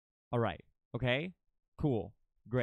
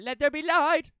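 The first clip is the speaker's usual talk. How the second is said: higher and louder, godlike decree; grand proclamation; 10 semitones higher, 8 dB louder than usual. Acted. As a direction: proclaim it like a deity.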